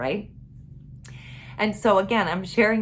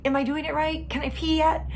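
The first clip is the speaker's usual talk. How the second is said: put-on little girl voice; 8 semitones higher than usual. Mother voices small daughter.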